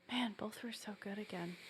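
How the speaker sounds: breathy unvoiced whisper, hushed; a private hushed aside, barely voiced. Real, not acted. Whispering to her cohost about the cards.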